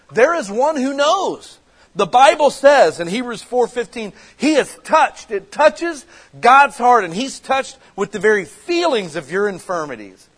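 high, loud impassioned preacher; ringing, emphatic pulpit delivery; full-force preaching. Real, not acted. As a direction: preach it out with rising urgency.

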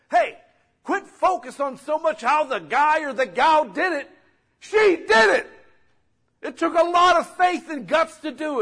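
loud, high, driving sermon climax; rally the congregation to cause a ruckus; fiery preaching. Acted. Pastor urging the church to do right, loud and high.

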